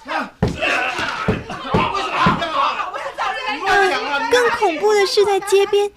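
high, breathless alarmed storytelling; scared excitement. Acted. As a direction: gasp at the horrors for kids. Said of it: "好恐怖啊".

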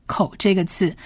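ordinary talk, nothing marked

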